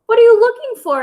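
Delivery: high, loud curious hen. Acted. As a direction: ask like a nosy hen. Aunt Liz asks curiously.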